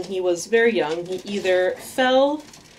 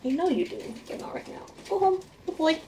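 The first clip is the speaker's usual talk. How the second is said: much higher, soft pet voice; 8 semitones higher, 6 dB quieter, faster than usual. Real, not acted. Owner sending her rat home in baby talk. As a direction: coo the rat back home.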